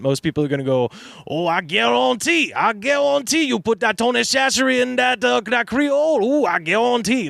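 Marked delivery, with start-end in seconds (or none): 1.3-7.3 put-on Cajun accent. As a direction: ham up a Cajun accent, drawing out guarantee. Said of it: "do the Cajun accent".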